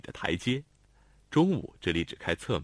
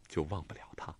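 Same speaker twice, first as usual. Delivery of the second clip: soft whisper; whispered thought; 6 semitones lower, 11 dB quieter than usual. A narrator's reading. Xiao Tong thinks of Qingchun, in a whisper. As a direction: breathe the thought to yourself.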